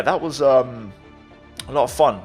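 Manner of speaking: usual speaking voice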